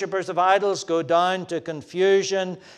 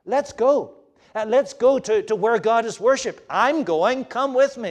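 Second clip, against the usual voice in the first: higher and louder, bright with eagerness; eager invitation; 7 semitones higher, 5 dB louder than usual. Acted. Preacher voicing converts urging others to come worship, eager and raised. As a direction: call your friends along, you can't wait to go.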